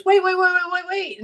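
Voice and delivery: calling after. Retold or imitated. Stranger calls her back.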